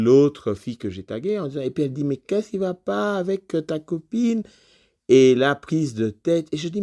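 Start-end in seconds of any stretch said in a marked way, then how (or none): 2.0-4.4 put-on puzzled girl's voice. Voicing the other girl's confused question.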